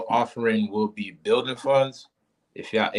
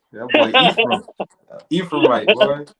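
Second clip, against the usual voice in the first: excited shout; 10 semitones higher, 8 dB louder than usual. Real, not acted. Cheering the speaker's accent.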